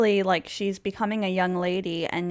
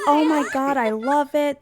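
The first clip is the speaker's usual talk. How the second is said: higher-pitched mock enthusiasm; acted gushing; 6 semitones higher, 4 dB louder than usual. King admiring her dress designs, high gushing. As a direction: gush over it, playing delighted.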